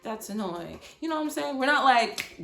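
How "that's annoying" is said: light imitation of laughing it off; mock chuckle among friends, trailing softer; chill joking. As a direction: put on a soft, easy fake laugh, then brush it off. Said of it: "we still be chill".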